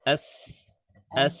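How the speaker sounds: "As" ending in a long hissed s, air pushed out between the teeth; a teacher drilling the letter sin with sukun; hissed s sound. Shown as a demonstration. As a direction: sound the s of "As" as a steady hiss through the gaps in the teeth. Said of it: "Ini suara keluar dari celah-celah sini".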